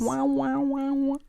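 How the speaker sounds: high wavering alien wow. Pretend. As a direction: warble like a cartoon alien. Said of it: "That's my alien noise".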